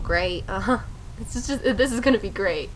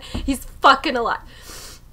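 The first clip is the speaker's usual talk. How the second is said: normal talking voice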